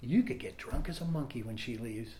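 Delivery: sly inner voice. Retold or imitated. His mind quietly tempting him as his partner plans to leave, hushed. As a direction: murmur it like a devil in his ear. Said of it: "the thought came in".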